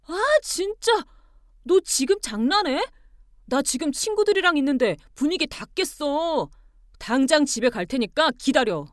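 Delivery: high, loud angry outburst; furious exasperation. Acted. Husband out with friends phoning wife, fuming. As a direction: fume loudly, then warn. Husband fumes, threatens to come.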